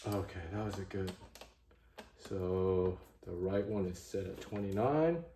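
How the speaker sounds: ordinary talk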